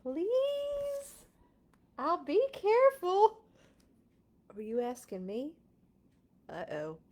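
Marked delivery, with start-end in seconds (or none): 0.0-3.4 high, eager begging voice; pleading pigeon. Acted. Pigeon begging the reader to drive, high and eager. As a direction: beg sweetly like a kid.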